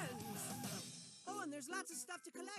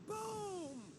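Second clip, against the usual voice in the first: higher, drawn-out spoken boom; mock lightning boom; 5 semitones higher, 3 dB louder than usual. Narrator acts out lightning, high hammy booming. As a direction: voice the lightning strike as a big boom.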